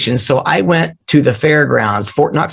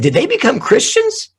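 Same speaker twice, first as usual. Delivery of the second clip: pitched way up in disbelief; surprised question. Retold or imitated. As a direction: ask it like you can't believe it. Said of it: "I was like".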